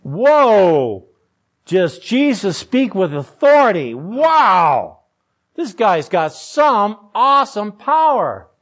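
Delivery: loud, swooping astonishment; acted wide-eyed awe; amazed reaction. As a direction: marvel out loud like a stunned onlooker. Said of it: "Their reaction is".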